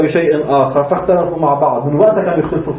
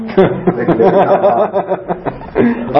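long, higher-pitched laughing fit; real hearty laugh; 5 semitones higher than usual. Real, not acted. Teacher amused by the tale of village landowners, hearty laugh. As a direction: burst out laughing at your own story. Amused by his own anecdote.

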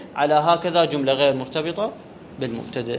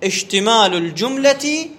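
slow dictation; 2 semitones higher, slower than usual. Shown as a demonstration. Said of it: "teacher dictating slowly".

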